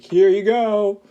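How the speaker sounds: high, loud show-off call; mock presenting call. Friends holding their food up to the camera, mock presenting call.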